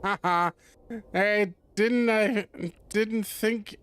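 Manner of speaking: usual speaking voice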